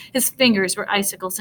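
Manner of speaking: plain reading voice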